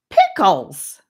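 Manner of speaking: high, loud exclamation; a boy's outburst, voice soaring; soaring shout. A narrator's reading. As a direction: let it fly up high and loud. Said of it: "raising his voice to a soaring height".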